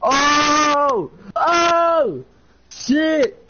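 high startled yells; shocked shout. Real, not acted. Friend reacting to a name being dropped, shocked shout. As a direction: jump out of your skin with a yell.

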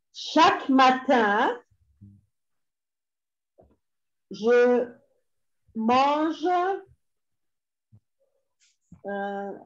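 word by word with long pauses for writing; slow dictation. Shown as a demonstration. Dictating the third French sentence.

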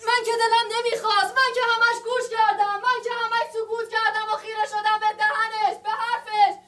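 anguished outburst. Acted. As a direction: let the grievances spill out all at once.

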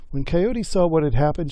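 usual speaking voice

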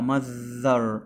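"mother" slowly, with a long buzzing TH; voiced TH. Shown as a demonstration. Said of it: "والتي اتش بنطقها ذ". ("mother" بحرف ذ ممدود)

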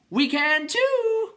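very high, drawn-out yell; called down. A narrator's reading. Clown calling down to Bastian, shrieking long yell. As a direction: holler it down from a height, stretched out. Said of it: "the first called down to Bastian".

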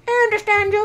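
very high squeaky falsetto; cow's reply voice. Pretend. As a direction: answer as an obedient little cow. Answers as the cow.

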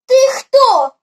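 loud, high, curious question; direct question. A narrator's reading. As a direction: call it out to a stranger. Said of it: "спитала голова звичайним людським голосом".

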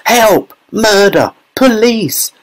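high-pitched hysterical screams; frantic, high mother's cries; Missus Gloop. A narrator's reading. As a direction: shriek for help in separate bursts. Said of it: "screamed Missus Gloop".

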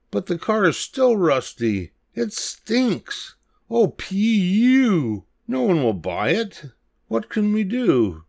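high, squeaky monkey voices, drawn-out disgusted P U; worried little monkeys. Acted. Monkeys griping about the stinky car, high and squeaky. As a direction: fret like kids holding their noses.

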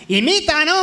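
high mock demand; heckling audience member. The crowd imitated demanding impressions, high mock call. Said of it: "La peña".

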